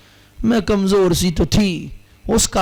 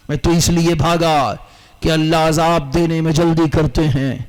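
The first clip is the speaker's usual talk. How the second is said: ordinary talk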